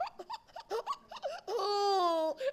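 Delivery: high mimicked baby wail; baby cry. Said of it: "I just kept crying".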